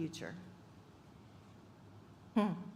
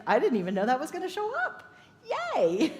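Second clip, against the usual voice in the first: higher, fast and bubbling over; delighted; 6 semitones higher, 5 dB louder than usual. Retold or imitated. Surprised by Spirit, bursting with delight. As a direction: squeal it out like a happy surprise. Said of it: "It's like".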